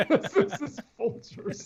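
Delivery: high, slow words through laughter; laughing. Real, not acted. Guest joking about the coffee brand, laughing. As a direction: laugh your way through the line.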